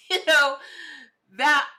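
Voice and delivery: big loud laugh through the name; a real hearty laugh, high and loud. Real, not acted.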